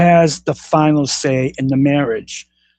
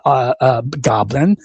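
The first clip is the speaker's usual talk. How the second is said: ordinary talk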